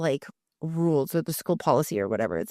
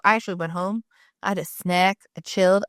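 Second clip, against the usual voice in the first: normal talking voice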